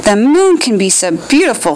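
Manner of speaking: ordinary delivery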